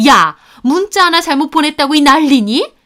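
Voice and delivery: high, loud rebuke rising at the end; outraged scolding. Acted. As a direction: demand indignantly why all the fuss. Mother-in-law scolds over one text.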